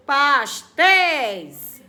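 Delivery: "Pastéis" very slow and loud; stressed final syllable. Shown as a demonstration. Teacher showing pupils the strong syllable, "Pastéis" split slowly with "téis" loud. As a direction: stretch "Pastéis", booming on "téis". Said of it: "qual é a sílaba mais forte".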